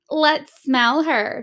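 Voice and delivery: slow mocking tease. A narrator's reading. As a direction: savor the teasing slowly. Kids teasing on playground.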